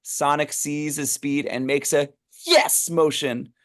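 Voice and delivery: usual speaking voice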